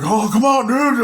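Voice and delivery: high, loud mock cheer from the crowd; yelling encouragement to the fighter, high and loud; egging on. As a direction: whoop him on like a hallway kid.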